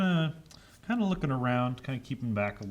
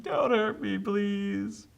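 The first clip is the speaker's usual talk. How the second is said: put-on higher, pleading kid voice; scared plea; 10 semitones higher, much slower than usual. Thomas pleading with the ghost, higher and scared. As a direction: beg not to be hurt. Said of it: "It's like".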